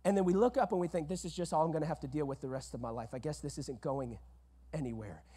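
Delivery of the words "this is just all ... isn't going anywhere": resigned murmur. Retold or imitated. Imitating a defeated believer, quiet and resigned. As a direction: drop low and soft, giving up. People resigned to their issues.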